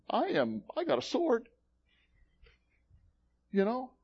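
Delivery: mock bravado, pitched well up; cocky bragging. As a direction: brag like a would-be tough guy. Acts Peter bragging about his sword.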